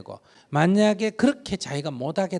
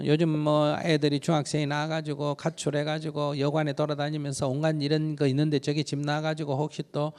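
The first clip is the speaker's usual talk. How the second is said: ordinary talk, nothing marked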